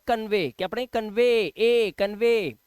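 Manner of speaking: कन्वे said "kan-vay" with a long ay; ay ending. Shown as a demonstration. Teacher drilling कन्वे for the class, long ay ending. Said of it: "ए का उच्चारण".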